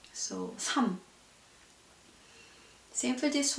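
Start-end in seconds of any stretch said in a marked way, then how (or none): 0.6-1.0 삼 ending on M, no L; 삼 with the L left silent; only M pronounced. Shown as a demonstration. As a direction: close the word 삼 on a plain M. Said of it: "you only pronounce M".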